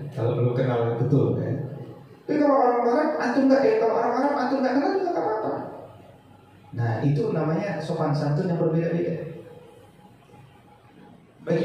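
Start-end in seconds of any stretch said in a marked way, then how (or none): 2.2-5.5 much higher, racing, playful; excited rush. Real, not acted. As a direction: tumble the words out, pitched high and amused.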